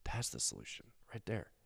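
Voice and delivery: very soft, breathy wonder; awed whisper. Retold or imitated. Thief sees Jesus, awed hush. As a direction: breathe it out in awe. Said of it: "he goes".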